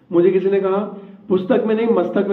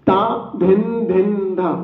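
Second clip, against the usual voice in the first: slow demo; much slower than usual. Shown as a demonstration. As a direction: show the class ता धिन धिन धा, slow and clear. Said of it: "देखिए, मैं करता हूं".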